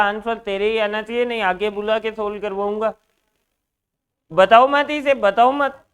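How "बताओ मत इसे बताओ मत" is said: loud, higher warning not to tell; sharp warning. Real, not acted. Teacher warns the class loudly not to tell the answer. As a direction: call out to stop them giving it away.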